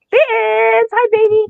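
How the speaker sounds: high, singsong hello; cooing hello; high greeting. Real, not acted. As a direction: call out a sweet high-pitched greeting. Greets someone, high pitched.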